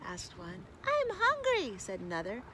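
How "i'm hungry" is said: put-on high-pitched hungry child voice; complaining young frog. A narrator's reading.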